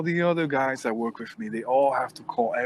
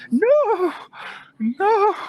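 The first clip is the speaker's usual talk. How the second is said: high, loud mock wail; protesting old woman; 6 dB louder than usual. Woman grabbed by the coat, high wailing protest. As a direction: wail the refusal in a squealing voice. Woman resisting being dragged out.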